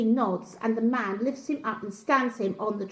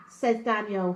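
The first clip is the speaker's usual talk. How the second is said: ordinary delivery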